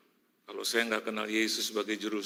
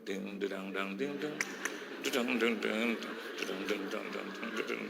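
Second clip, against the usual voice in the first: party music noise; 2 semitones lower, 6 dB quieter than usual. Retold or imitated.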